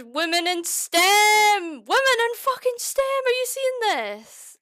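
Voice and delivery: high, loud mock cheer, drawn out; mock triumph. Streamer cheers her own gluing to chat, high and loud. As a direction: cheer yourself on like a winner.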